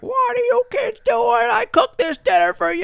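high, loud enraged shriek; crazed grandma. Retold or imitated. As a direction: yell like a grandma losing it at the kids. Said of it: "my grandma gets all crazy".

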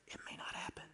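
hushed whispered warning. Real, not acted. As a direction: drop to a whisper.

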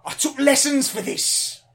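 high, slow wounded protest; indignant actor. Acted. Actor defending his performance to director, indignant protest. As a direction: protest like an offended thespian.

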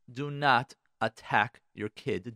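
ordinary talk

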